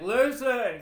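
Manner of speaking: big booming call; booming giant voice. Retold or imitated. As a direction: boom it out like a giant. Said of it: "'cause he's booming".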